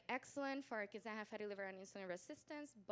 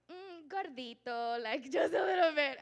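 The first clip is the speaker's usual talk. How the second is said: imitating a doting mom; 6 semitones higher, 14 dB louder than usual. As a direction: playfully coo it, breaking up louder. Imitates mother downplaying the weight.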